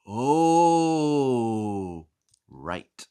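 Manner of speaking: ordinary delivery, nothing marked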